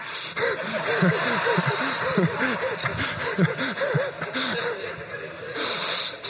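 a high, soft cackling laugh; high cackle. Retold or imitated. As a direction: pastor mimics the neighbor's high cackle. Neighbor's cackle retold.

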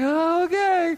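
high, drawn-out reluctant reply; nervous agreement. Retold or imitated. Retold reluctant reply to pilot.

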